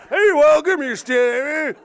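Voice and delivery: high, loud country-bumpkin greeting; mock pirate-farmer accent. Norfolk farmer popping up to greet travellers, loud mock rural accent. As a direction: hail them in a hammed-up farmer's accent. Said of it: "they sound the same".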